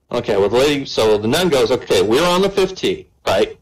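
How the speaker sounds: usual speaking voice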